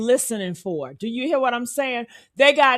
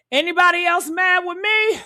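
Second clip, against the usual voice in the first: normal talking voice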